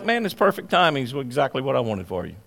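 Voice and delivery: reply like a relaxed, unbothered God; God's calm voice. Retold or imitated. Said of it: "And God's like".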